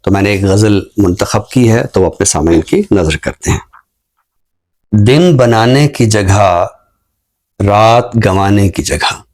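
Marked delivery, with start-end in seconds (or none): 4.9-9.2 slow, measured poetry recital with lingering line ends; ghazal recitation. Acted. Poet reciting his ghazal to the show's audience, slow and measured. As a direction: recite each line slowly, savouring it.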